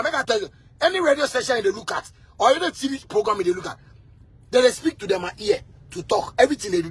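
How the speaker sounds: normal talking voice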